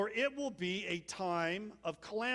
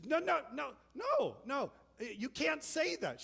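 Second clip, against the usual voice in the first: frantic protest; 7 semitones higher than usual. Retold or imitated. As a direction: refuse it in a panic, pitch up. Girl protesting the breakup.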